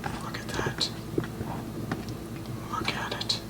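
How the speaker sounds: hushed whisper over the food. Real, not acted. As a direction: whisper it in awe. Admiring the food up close.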